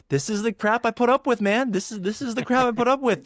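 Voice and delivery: retelling the frustration, voice pushed up; exasperated venting. Retold or imitated.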